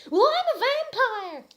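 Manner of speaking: hammed-up squeaky confession, very high and loud, a bit slow; a mock-proud girl admitting her secret; confessing. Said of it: "I confessed".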